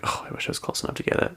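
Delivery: quick, breathy aside under her breath; hushed, quick inner wish; wistful aside. Retold or imitated. Inner thought while others catch.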